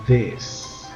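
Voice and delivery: ordinary delivery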